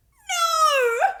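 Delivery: very high, loud yelp. A narrator's reading. Dog yelping at Davina's idea, loud. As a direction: yelp in protest. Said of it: "yelped Bennett".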